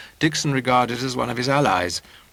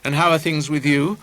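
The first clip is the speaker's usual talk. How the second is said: louder, lightly voiced jovial gentleman; hearty, amused greeting; 3 semitones higher, 5 dB louder than usual. A narrator's reading. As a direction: greet her expansively, a touch amused. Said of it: "remnants of his laughter still trickling".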